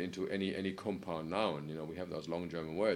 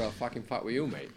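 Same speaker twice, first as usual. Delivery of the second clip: put-on a touch higher, playful London accent; mock cockney threat; 4 semitones higher, 4 dB louder than usual. Imitating a London lad squaring up to a mate, light cockney accent. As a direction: toss it off like a cockney lad mouthing off. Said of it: "especially in certain parts of London".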